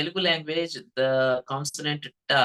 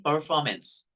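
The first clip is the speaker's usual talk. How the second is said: American "performance", slowly, r sounded; American accent; slower than usual. Shown as a demonstration. Teacher demonstrates American r in "performance". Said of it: "This is American pronunciation".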